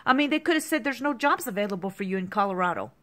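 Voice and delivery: usual speaking voice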